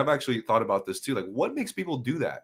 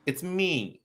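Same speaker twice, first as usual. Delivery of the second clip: mock narcissist; 6 semitones higher than usual. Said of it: "So they just hear it".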